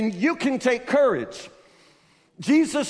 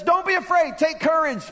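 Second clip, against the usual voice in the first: usual speaking voice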